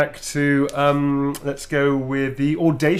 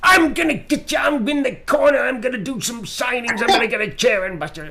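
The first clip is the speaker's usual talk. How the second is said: much higher, shouted wrestling promo; Macho Man voice; 9 semitones higher than usual. Retold or imitated. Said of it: "I'm gonna use Macho Man Randy Savage again".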